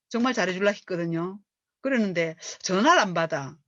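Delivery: normal talking voice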